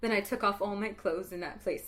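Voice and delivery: ordinary delivery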